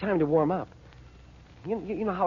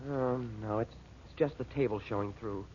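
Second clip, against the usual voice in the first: lower, much softer, let down; deflated letdown; 6 semitones lower, 9 dB quieter than usual. Acted. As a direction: let the hope drain out of the voice. False alarm, letdown.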